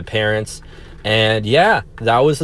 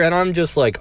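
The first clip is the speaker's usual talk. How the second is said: ordinary delivery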